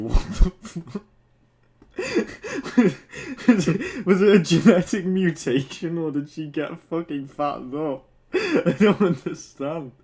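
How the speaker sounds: loud, high disbelieving laughter; cracking up mid-question; real laughing fit. Real, not acted. Streamer cracking up at story.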